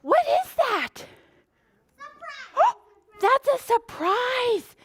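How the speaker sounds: high, gasping mock surprise; playful surprise. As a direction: act amazed for small children. Reveals surprise egg to children.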